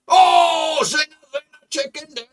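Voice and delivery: big shouted celebration, high and loud; goal celebration. Real, not acted. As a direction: yell it out like you just scored. Team scores, he celebrates.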